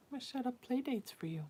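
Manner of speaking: soft whispered promise. Real, not acted. Mom whispers to her cat about play dates. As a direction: breathe it to the cat.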